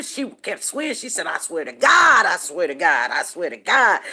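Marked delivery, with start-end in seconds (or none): 1.3-4.0 loud retold yell, I swear to God repeated; retold screaming. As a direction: shout it like the girl swearing in the street. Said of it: "she was hollering and screaming".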